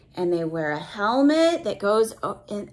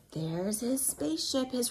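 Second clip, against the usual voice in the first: whisper; 9 dB quieter than usual. Acted. Hushed pointing out to child.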